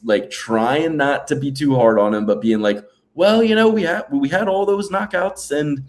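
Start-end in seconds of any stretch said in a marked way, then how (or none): 3.1-5.5 put-on higher-pitched, rough stab at a British accent; badly faked British podcaster; attempted British accent. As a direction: hedge gently in a shaky British accent. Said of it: "I can't do the British accent".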